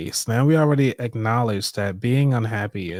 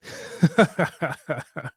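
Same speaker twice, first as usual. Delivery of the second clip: real laugh; 5 semitones higher than usual. Real, not acted. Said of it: "it becomes funny again".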